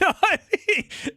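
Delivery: a sudden high-pitched giggle. Real, not acted. Bragging about old girlfriends, high squeaky giggle. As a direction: burst into a squeaky laugh.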